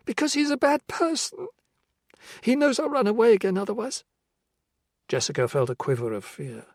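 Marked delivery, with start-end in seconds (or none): 0.1-4.0 put-on high, childlike girl warning calmly; a small girl matter-of-factly warning about her dad; young girl's voice. Girl in the cellar warns Jessica about her father: high childlike voice.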